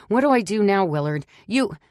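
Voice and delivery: high, loud and frantic; pleading with an absent Willard, panicked. A narrator's reading. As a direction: blurt it out in panic, near a shriek. Panicking over the husband.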